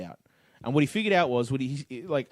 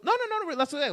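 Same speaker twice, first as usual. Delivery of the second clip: high mock reassurance; 14 semitones higher than usual.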